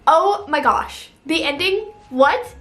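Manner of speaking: stretched-out loud exclaim; real drawn-out amazed outburst. Real, not acted. Opening her spoiler review, loudly stunned.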